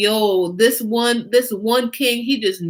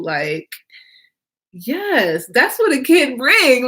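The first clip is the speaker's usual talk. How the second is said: ordinary delivery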